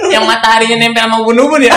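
laughing through the words; half laughing, half talking. Real, not acted. Teasing joke, laughing while speaking.

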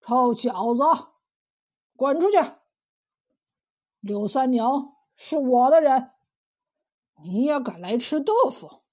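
mildly acted bully telling off an underling; 恶霸呵斥. 庞雄呵斥八秀才. (带点怒气地呵斥)

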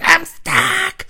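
very high, loud whine; stuck kid. Retold or imitated. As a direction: cry down from the tree.

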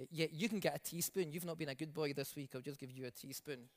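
low, soft and fast grudging voice; a preacher mocking the idea of a tight-fisted God, low and soft; mock stingy giver. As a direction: mutter it like a miser handing out crumbs.

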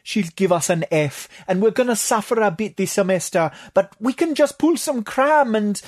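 high, rushed pleading; high-voiced anxious plea. A narrator's reading. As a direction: reason desperately, pitch climbing as it goes. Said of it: "Tatok pleaded".

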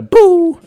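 loud drawn-out "boo" sound effect; imitated crash noise. Driver showing how the car got knocked, imitated crash noise. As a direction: blurt out a long impact noise.

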